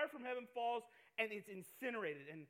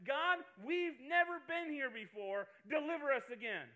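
pleading prayer; 4 semitones higher, 5 dB louder than usual. Pretend. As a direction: beg for rescue. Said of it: "on our knees going".

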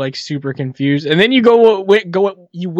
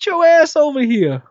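calling out; 10 semitones higher than usual. Retold or imitated. Summoning a friend across the hall.

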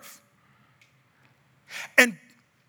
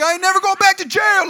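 hammed-up high, loud yell; mock defiant scream; 10 semitones higher, 7 dB louder than usual. Mouthy friend screaming at the cop, mock defiance. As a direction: holler it like a movie convict. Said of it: "he's screaming at the cop".

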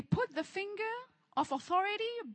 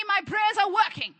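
call-and-response declaration; 4 semitones higher, 10 dB louder than usual. Shown as a demonstration. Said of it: "Come on, say with me".

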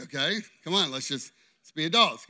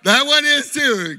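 louder, laughing through words; 9 dB louder than usual. Real, not acted. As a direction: keep laughing while you talk. Laughing at volunteer's finger.

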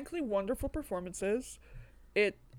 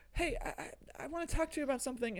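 whispered, hurried imitation of her; rushed, hushed girlish voice; 6 semitones higher, 7 dB quieter, faster than usual. Host imitating the heroine, whispered and hurried. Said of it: "she's always like".

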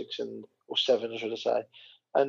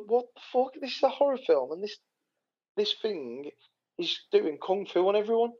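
high-pitched, incredulous rant; retold disbelief; 8 semitones higher than usual. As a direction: act out your bewilderment at the absurdity. Retelling his disbelief at the film.

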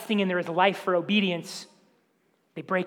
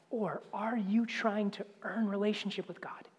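whispered challenge; 9 dB quieter than usual. Real, not acted. Probing question to listeners.

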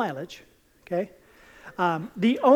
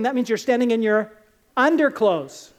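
ordinary delivery

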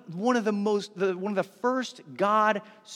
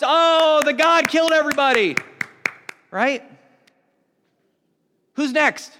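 loud, higher mock cheer from the listeners; mock excited crowd; 8 semitones higher, 9 dB louder than usual. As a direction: act out the clapping crowd's cheer. Crowd reacts around the fire.

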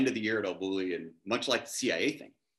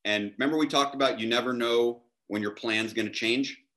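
ordinary talk

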